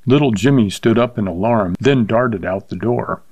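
usual speaking voice

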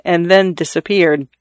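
usual speaking voice

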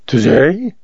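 astonished rising question; a gasped, incredulous query; startled gasp. A narrator's reading. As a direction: echo it in startled disbelief. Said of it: "gasped Ole".

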